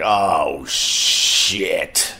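low, drawn-out "oh, shit" of realization; retold dismayed reaction; sinking dread. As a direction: let it sink in, low and heavy. Reacts to neighbors carrying dying dog.

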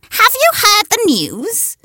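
high, slow, prim hen voice; hen's question. A narrator's reading. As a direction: ask it like a busybody hen. Henrietta's boastful question voiced.

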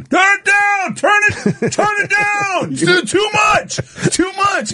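high, frantic yelling to turn it down; panicked shout. Retold or imitated. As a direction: scream it like the music is crushing you. Retold panicked plea to friend.

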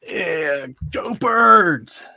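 rally the fans; proud sports-fan cheer; team cheer. Real, not acted. Cheering for the Eagles.

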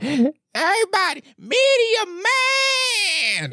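high, stretched-out announcer cry; Chris Tucker voice. Retold or imitated. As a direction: belt it like a hyped little announcer. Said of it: "In his little Chris Tucker voice".

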